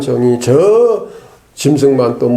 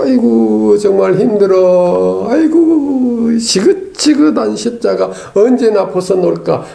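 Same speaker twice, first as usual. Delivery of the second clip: hammed-up weary complaint; 7 semitones higher than usual. Believer tired of carrying the cross, mock whining. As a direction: moan theatrically under a heavy load.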